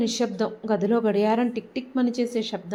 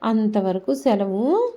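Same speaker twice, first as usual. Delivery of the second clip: upbeat YouTube storyteller closing; channel outro; 3 semitones higher, 3 dB louder than usual. Real, not acted. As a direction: warmly invite viewers to like and subscribe. Channel outro asking for likes.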